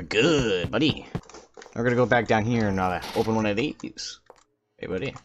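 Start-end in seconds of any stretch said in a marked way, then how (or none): none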